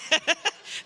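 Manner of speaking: a quick laugh rising up; bright rising chuckle; short laugh. Real, not acted. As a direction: laugh at the memory. Laughs while telling story.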